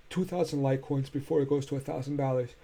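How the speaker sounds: talking through a yawn; words stretched by a yawn. Real, not acted. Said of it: "I'm sorry that I'm yawning".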